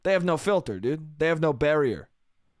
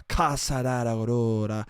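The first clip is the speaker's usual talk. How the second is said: low, falling string of nonsense; mock their incoherent talk in a lower voice; imitated model gibberish; 7 semitones lower than usual. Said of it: "They were like".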